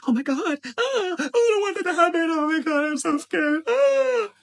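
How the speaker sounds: high, loud, hammed-up panic with a cry; mock panic. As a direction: play the terrified listener, over the top. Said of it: "your ear's like".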